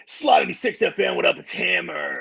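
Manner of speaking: drawn-out radio DJ hype; Hammer. Retold or imitated. Imitating a friend going on the air, stretched DJ voice. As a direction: stretch out an over-the-top station ID. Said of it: "every time you go on the air, he's like".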